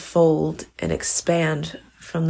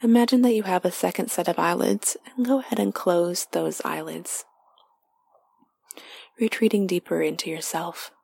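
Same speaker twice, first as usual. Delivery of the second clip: guided meditation; 2 semitones higher than usual. Acted. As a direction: lead them inward gently, half whispered.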